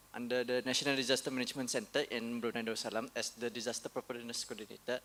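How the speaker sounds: ordinary talk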